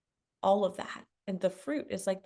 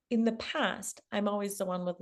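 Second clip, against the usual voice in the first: normal talking voice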